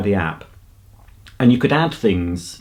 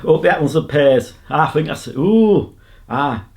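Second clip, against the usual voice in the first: higher-pitched mock southern English accent; attempted southern accent; 5 semitones higher than usual. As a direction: fumble for a common southern accent. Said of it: "southern accent".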